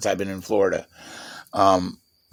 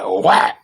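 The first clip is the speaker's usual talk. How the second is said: whack sound effect; 7 semitones higher, 6 dB louder than usual. Retold or imitated. Mimicking the strike.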